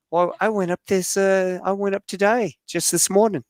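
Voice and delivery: put-on high-pitched Kiwi woman; jumpmaster's wife. Jumpmaster's pregnant wife says she jumped today, imitated high voice. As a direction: mimic a New Zealand woman, high voice.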